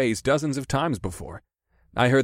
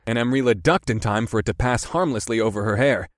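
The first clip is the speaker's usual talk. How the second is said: ordinary delivery, nothing marked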